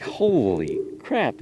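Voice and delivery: slow, drawn-out holy crap; stunned. Retold or imitated. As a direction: let it out slowly, floored. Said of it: "And I'm like".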